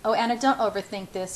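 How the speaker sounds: ordinary delivery, nothing marked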